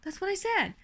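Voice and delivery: ordinary delivery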